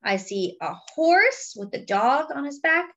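plain reading voice

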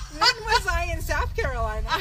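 high and loud, laughing through it; can't believe you missed a whole state. Real, not acted. Telling her friend about the long drive, laughing. Laughing at own road mistake.

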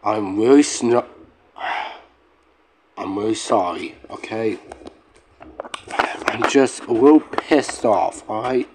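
low, soft, subdued apology; deflated and sorry, voice dropped; apologetic. Real, not acted. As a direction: back down quietly after snapping. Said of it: "I'm sorry if I snap at".

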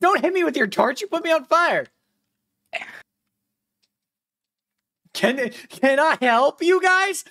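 loud high-pitched complaint; yelling. Real, not acted. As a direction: shout the protest. Set on fire, complaining loudly.

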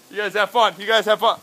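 high, loud cheery send-off; mock exit. Telling the crowd to enjoy while exiting, high and loud. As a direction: wave them off as you walk away. Leaving stage, acting out the show.

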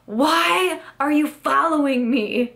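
high, slow mock yell at me; retold yell. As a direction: imitate her snapping back, drawn out and high. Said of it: "turns around and yells at me".

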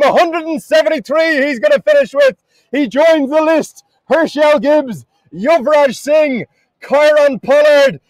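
high-pitched, roaring cricket commentary climax; ecstatic shouted call. Real, not acted. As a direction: shout the historic moment, drawing out the big names. Commentator calls record sixth six.

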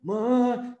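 high, drawn-out stammer going nowhere; mock someone who freezes, stuck on stretched vowels; blank and panicked. Business owner stumped by what they know, blank and panicked. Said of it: "People go blank".

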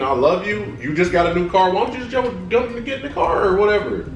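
ordinary talk, nothing marked

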